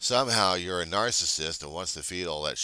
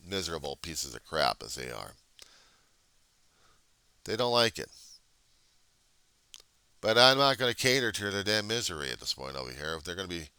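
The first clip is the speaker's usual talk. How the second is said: ordinary talk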